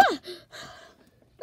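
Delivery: usual speaking voice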